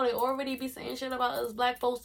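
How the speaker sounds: ordinary talk, nothing marked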